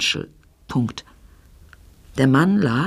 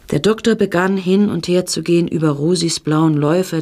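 ordinary delivery, nothing marked

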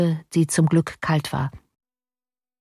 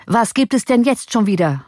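irritated, higher-pitched outburst; angry; 6 semitones higher, 3 dB louder than usual. A narrator's reading. Cornelius to the intruder at his door, angry. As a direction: demand it with mild exasperation. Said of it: "lief vor Zorn rot an".

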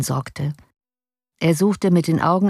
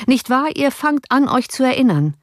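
higher-pitched, pointed judge; bedrängender Richter; 8 semitones higher than usual. A narrator's reading. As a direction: press the suspect for an admission. Richter setzt Cornelius unter Druck. (eindringlich nachhakend)

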